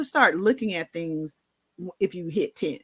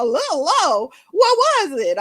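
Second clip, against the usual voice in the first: shocked disbelief; 12 semitones higher, 8 dB louder than usual. Retold or imitated. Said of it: "I'm like".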